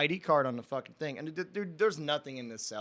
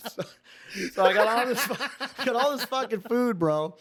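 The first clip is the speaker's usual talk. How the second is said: higher, laughing as he talks; words bubbling with laughter; laughing through the words; 8 semitones higher, 4 dB louder than usual. Real, not acted. Cracking up while telling his friend the story, laughing.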